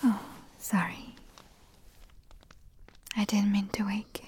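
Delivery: usual speaking voice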